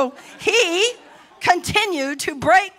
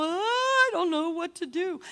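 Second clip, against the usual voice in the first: mock mopey whine; 6 dB quieter than usual. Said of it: "don't just lay there and go".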